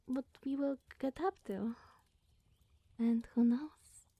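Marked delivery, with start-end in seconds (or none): 3.0-4.1 teasing. Acted. Teasing, suggestive hint.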